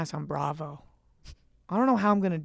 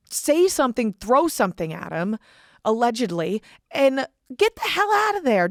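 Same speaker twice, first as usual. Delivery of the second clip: rising, louder, furious call-out; angry rant; 7 semitones higher, 5 dB louder than usual. Real, not acted. Host warns listeners about Andrew Tate, furious shout. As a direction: rail at the audience, voice climbing high.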